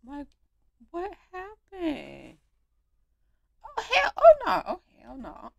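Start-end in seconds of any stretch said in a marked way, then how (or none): 3.6-5.5 high, loud hell no; outraged yell. Real, not acted. Gamer shouting as Penny walks off, outraged. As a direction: burst out in disbelief.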